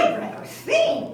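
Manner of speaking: high, breathy piglet voice; whiny piglet. Acted. First pig tells Mama he'd rather sing. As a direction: whine it childishly. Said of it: "said the first little pig".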